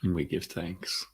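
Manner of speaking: calm centering voice with long pauses between phrases; guided meditation. Acted. Teacher centering the group before class, calm guided meditation. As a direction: guide them gently into the breath, unhurried.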